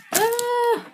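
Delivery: sudden high yelp as the box gives way; a real little shout of surprise; startled cry. Real, not acted. Vlogger yelps when her calendar box breaks, startled.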